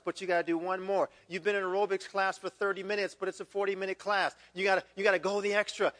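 ordinary delivery

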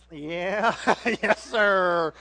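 a hint of a smug mechanic; lightly voiced garage guy. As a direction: play the knowing garage man. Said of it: "the guy's like".

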